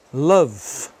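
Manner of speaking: "love" ending on v, no vowel; silent final E. Shown as a demonstration. Teacher reading out the written pronunciation, silent E in "love". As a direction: stop "love" on the v. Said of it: "se tiver o I, você pronuncia".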